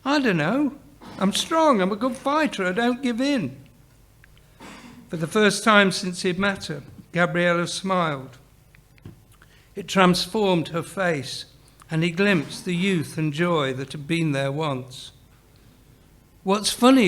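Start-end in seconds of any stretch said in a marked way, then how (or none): 0.0-3.6 put-on very high, eager young voice; eager self-promotion. A narrator's reading. Sydney convinces Gabriella he can fight, eager. 16.4-17.1 high, loud demand. A narrator's reading. Sydney challenges Gabriella's smile, demanding. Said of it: "he demanded".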